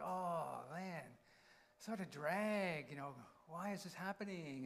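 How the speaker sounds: imitated weary complaint; mock grumbling. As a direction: grumble it as if put upon. Imitating people reacting to poor circumstances.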